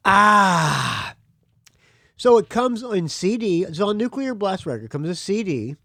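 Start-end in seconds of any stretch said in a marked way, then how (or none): 0.1-1.1 loud, open "ah" after a swig; satisfied sigh after a drink. Real, not acted. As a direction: let out a big beer-refreshed ahh. Just opened second beer.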